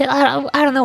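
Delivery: ordinary talk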